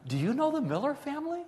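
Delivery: very high, delighted question, acted out; smiling ear to ear. Beaming official asking the grandmother about a family, eager high voice. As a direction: ask it through a huge grin, pitch soaring. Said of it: "smiling ear to ear".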